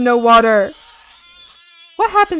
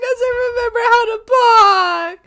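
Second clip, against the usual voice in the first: high mock-crying frog; 12 semitones higher, 3 dB louder than usual. Voicing the frog.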